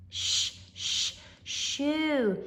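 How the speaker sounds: "sh-, sh-" hissed, then "shovel"; sound-out. Shown as a demonstration. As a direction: stretch the sh before the word. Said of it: "S H".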